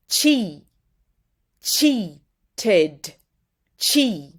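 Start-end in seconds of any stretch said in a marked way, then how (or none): 0.1-3.1 "Chi" then "Cheated" with the throat buzzing; voiced chi. Shown as a demonstration. Teacher showing how to voice the "chi" in "Cheated". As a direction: stretch the "chi" so the throat vibrates. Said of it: "Feel your throat".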